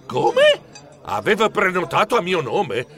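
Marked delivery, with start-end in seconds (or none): 0.1-0.6 very high, startled question; high rising yelp of surprise. A narrator's reading. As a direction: squeal it in disbelief. Surprised at booking in his name.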